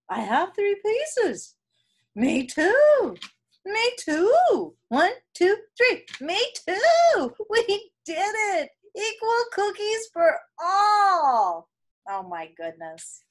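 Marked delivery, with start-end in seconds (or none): 0.1-11.7 higher, brighter character voices; triumphant cheer; happy relief. Acted. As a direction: announce it with relief. Characters celebrate.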